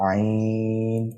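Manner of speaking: عین drawn out slowly from the throat; throat letter ain. Shown as a demonstration. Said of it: "جس جگہ سے ہا کو ادا کرتے ہیں، حلق سے". (عین کو حلق سے لمبا کھینچیں)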